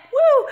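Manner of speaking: short high-pitched whoop; celebratory woo. Real, not acted. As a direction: let out a little victory "woo". Cheers finding the meat.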